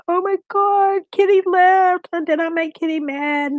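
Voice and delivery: shocked worry, voice way up; startled oh-my-God fretting; alarmed gasp. Retold or imitated. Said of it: "I was like".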